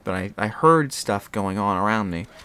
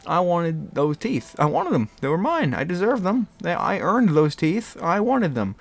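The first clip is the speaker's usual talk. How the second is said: whiny; 6 semitones higher than usual. Real, not acted. Whining about the lost teeth.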